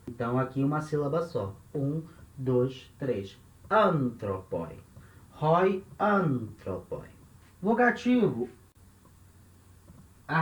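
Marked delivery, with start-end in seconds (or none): 3.7-4.8 "Antropoi" drawn out, stressed on the opening An; stress on first syllable. Shown as a demonstration. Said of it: "o acento continua caindo aqui, logo na primeira sílaba". 6.0-7.1 "antropoi" said slowly, accent on An; teacher's slow model of "antropoi", An stressed; stress on first syllable. Shown as a demonstration. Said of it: "logo na primeira sílaba".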